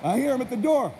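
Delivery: ordinary talk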